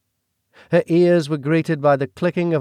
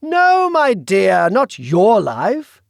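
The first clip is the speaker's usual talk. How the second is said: British villain voice; 9 semitones higher, 8 dB louder, much slower than usual. Acted. The Duke speaks.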